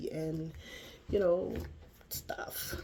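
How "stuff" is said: breathy whispered aside; whispered stuff. Real, not acted. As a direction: whisper it like a little secret. Whispered aside about good deeds.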